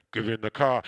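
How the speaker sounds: low, loud divine order; God. Retold or imitated. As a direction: drop into a deep, commanding voice. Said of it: "And God saying".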